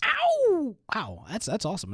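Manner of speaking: ordinary talk, nothing marked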